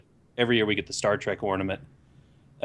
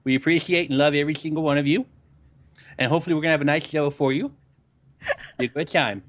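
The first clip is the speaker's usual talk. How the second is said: higher, showy welcome in a put-on voice; put-on show host; 4 semitones higher than usual. Co-host welcoming the chat to the show, put-on host voice. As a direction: play the emcee greeting the crowd.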